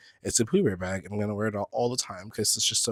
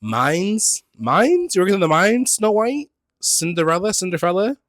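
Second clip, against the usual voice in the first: high, loud mocking questions; mocking herself over "mine's"; mock teasing; 8 semitones higher, 6 dB louder than usual. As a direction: tease the word like a cartoon. Mocking her own mispronunciation.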